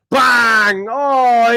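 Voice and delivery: ordinary talk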